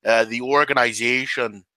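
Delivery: slow, drawn-out Canadian hockey-player voice; hammed-up Canadian interview drawl; Canadian accent. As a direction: put on a bad Canadian accent, dragging it out. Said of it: "I have to talk like this".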